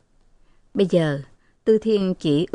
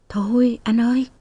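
higher-pitched stage whisper; pleading whisper; 5 semitones higher than usual. A narrator's reading. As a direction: whisper it as if into an ear, raised in pitch. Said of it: "ghé vào tai Chiến thì thầm". (thì thầm vào tai, giọng cao hơn)

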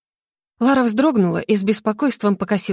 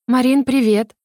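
cheery greeting, pitched higher; cheerful; 4 semitones higher than usual. A narrator's reading. Larisa greeting her younger sister, feigned cheerfulness. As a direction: greet brightly, putting on good cheer. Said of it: "Хотя говорила Лариса жизнерадостно".

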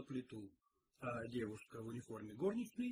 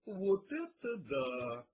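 long drawn-out, raised exclamation; delighted gasp; 5 semitones higher, 5 dB louder, much slower than usual. A narrator's reading. Detective sees the plate of chicken, delighted. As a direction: draw it out slowly in happy amazement. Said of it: "обрадованно охнул Пил".

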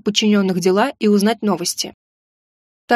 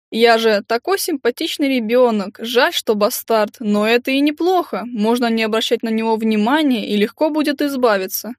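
lightly mimicking a mincing lady; a hint of a simpering woman; affected little voice. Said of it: "Жеманным голоском, явно копируя кого-то".

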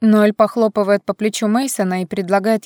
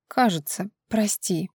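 quiet tipsy apology; 7 dB quieter, slower than usual. A narrator's reading.

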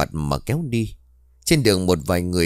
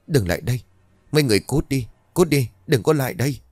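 high, panicked driving away; terrified shout; 6 semitones higher, faster than usual. A narrator's reading. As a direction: scream them away in fear. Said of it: "Mụ Liên run rẩy rồi bảo". (giọng hoảng sợ xua đuổi)

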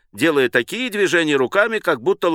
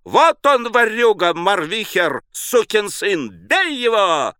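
high, furious mob cry; raised, outraged yelling; howling accusation; 8 semitones higher, slower than usual. A narrator's reading. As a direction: howl for the thief to be beaten. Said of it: "кто-то взвыл в небесах".

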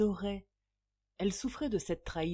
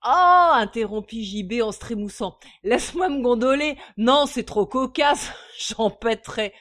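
loud, high whoop breaking into laughter; wild hilarity; 5 semitones higher, 14 dB louder than usual. A narrator's reading. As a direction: crack up like a tickled drunk. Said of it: "nouvelle explosion de folle joie". (folle joie, hilare)

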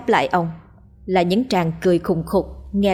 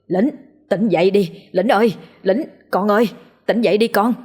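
desperate calling. A narrator's reading. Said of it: "theo những tiếng gọi thảm thiết".

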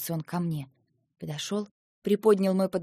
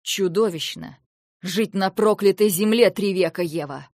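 louder, heated outburst; furious retort; 3 semitones higher, 9 dB louder than usual. Acted. Lentar answering Eva's accusation, furious shout. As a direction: throw her word back at her in rage.

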